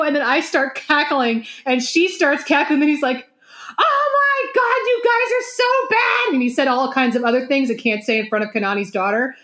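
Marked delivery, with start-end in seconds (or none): 3.8-6.3 high, drawn-out scandalized wail; mock outrage. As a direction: act out a friend's outraged relief. Acting out friend's outburst at reveal.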